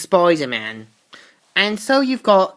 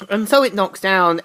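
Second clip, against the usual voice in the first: usual speaking voice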